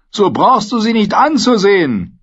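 high, loud crude jeering voice; leering Dornish spearman. Acted. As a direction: egg on his comrade lewdly. Dornischer Speerträger grölt. (grölender Speerträger)

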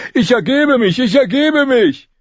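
very high, loud frantic cries; high-pitched, desperate wailing; panicked repeated surrender. A narrator's reading. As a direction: shout it over and over in panic. Runs forward waving arms, surrendering.

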